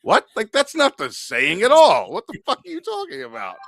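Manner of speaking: high, loud disbelief; can't believe what he heard; incredulous. Real, not acted. Man baffled by coworker's saying, loud high disbelief. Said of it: "exasperated disbelief".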